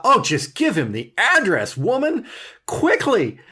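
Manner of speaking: exasperated outburst at a dithering character; mock exasperation. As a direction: urge them on in playful exasperation. Acting out impatience at a character.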